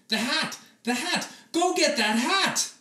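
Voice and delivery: high, eager cry; urgent excited discovery; excited boy. Acted. Oliver excitedly tells the family to fetch the hat, high and eager.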